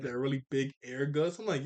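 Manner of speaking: ordinary delivery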